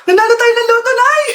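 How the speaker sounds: high, loud joyful shout; excited news. Acted. Child telling mother they won the lottery, shouting with joy. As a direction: burst out with the good news.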